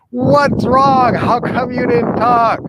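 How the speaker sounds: high, loud exasperated yell; frustrated outburst. Acted. Man to his dog that wouldn't talk, frustrated shout. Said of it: "gets so frustrated".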